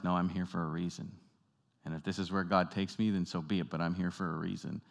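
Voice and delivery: soft, low inner conviction; quiet resolve. Retold or imitated. Rescuer in a sinking car telling himself, softly resolved. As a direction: settle yourself quietly, accepting.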